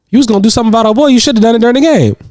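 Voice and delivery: high, fast mock-brother needling; imitated brother goading, high and rapid; button-pushing jab. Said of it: "family knows how to press the buttons".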